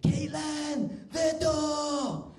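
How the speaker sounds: loud, high, sung-out yell; mum's bellowed summons, drawn out; calling out. Acted. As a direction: holler through the house for someone. Mum calling daughter to door.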